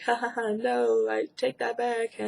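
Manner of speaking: awkward snicker. Retold or imitated. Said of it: "awkwardly snickering".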